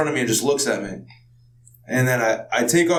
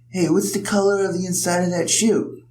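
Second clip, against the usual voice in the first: higher, put-on curious voice; imitated stranger; 5 semitones higher than usual. Stranger asks about his shoe, mock curiosity.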